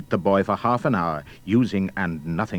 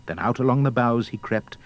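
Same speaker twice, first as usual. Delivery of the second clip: usual speaking voice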